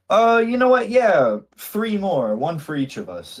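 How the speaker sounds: high, loud tipsy cheer; Lenny voice. Acted. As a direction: pitch way up, cheerfully order another round. Lenny NPC orders drinks.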